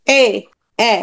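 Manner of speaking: এ sounded alone, tongue slightly forward; front vowel demo. Shown as a demonstration. Teacher shows students where the tongue sits, demonstrating front vowel এ. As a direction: say each এ as a separate front vowel. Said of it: "জিভ একটু সামনের দিকে".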